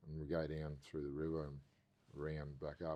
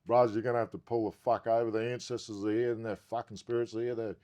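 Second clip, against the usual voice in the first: louder, higher urgent plea; urgent demand; 6 semitones higher, 11 dB louder than usual. Retold or imitated. Telling his mate to pull the car over, loud and urgent. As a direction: insist he pulls over now.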